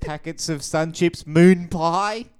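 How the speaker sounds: high, loud, drawn-out over-the-top voice; exaggerated voice. Shown as a demonstration. Reading off the screen on cohost's request, hugely exaggerated. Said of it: "give it to me in an exaggerated voice".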